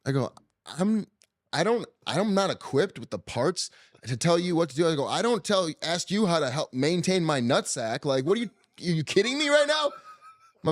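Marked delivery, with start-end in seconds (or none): 0.8-4.9 higher-pitched retold protest; exasperated. Husband refusing wife's plea for advice, exasperated. 5.1-9.9 high, incredulous mock rant; indignant. Husband asked for labor advice by wife, indignant.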